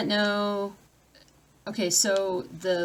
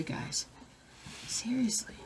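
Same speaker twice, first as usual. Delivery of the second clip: exasperated whisper; 4 semitones higher, 11 dB quieter, slower than usual. Real, not acted. Tired of the pets, an exasperated whisper. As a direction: hiss it under your breath.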